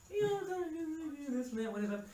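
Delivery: high, put-on brush-off; mock dismissive reply. Father answering about first voting, mock dismissive.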